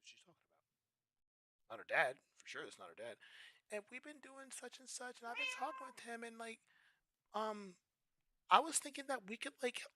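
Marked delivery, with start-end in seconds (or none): 3.7-9.8 put-on high, soft girlfriend voice; girlfriend's voice. Girlfriend proposing a threesome, high and soft. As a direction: float the idea sweetly.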